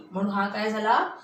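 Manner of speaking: ordinary delivery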